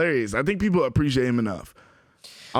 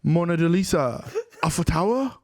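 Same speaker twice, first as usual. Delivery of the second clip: bad fake French accent, a touch higher; hammed-up French accent; 4 semitones higher, slower than usual. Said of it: "get that French accent going".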